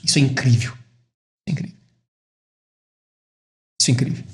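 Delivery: low, soft, falling almost to a whisper; hushed awe. Real, not acted. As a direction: drop your voice and marvel quietly. Preacher marvels, repeating it in hush. (admiração em voz baixa, quase sussurrada)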